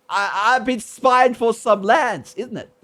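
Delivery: a lightly done Cockney question tag, laughing at the end; mock Cockney. Shown as a demonstration. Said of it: "learn the Cockney of the accents".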